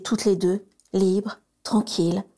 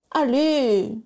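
gently impatient; 6 semitones higher than usual. A narrator's reading. Sirius hurries his cousin to the fireplace, impatient. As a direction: hurry her along, kindly. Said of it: "s'impatienta gentiment Sirius".

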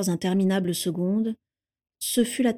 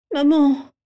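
loud, high appeal to mother; pleading cry; 7 semitones higher, 6 dB louder than usual. A narrator's reading. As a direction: cry out for her to step in. Daughter pleads with silent mother.

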